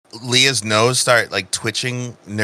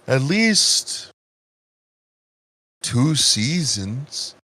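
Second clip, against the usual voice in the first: slow, halting plant character, lightly voiced; sleep-inducing voice. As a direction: take your time, long gaps between phrases. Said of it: "This man's voice makes me sleepy".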